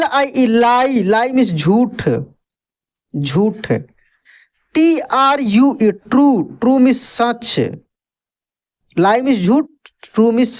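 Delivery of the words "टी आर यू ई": letters spelled out slowly, one by one; teacher dictating letters slowly; slow spelling. Shown as a demonstration. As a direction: spell it out for the class, unhurried. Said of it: "teacher spells word letter by letter".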